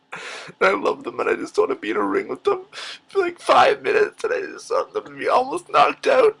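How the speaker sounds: hammed-up sobbing, pitch soaring to a high wail; crying. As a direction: blubber through it in a high, breaking voice. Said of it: "promised myself I wouldn't cry".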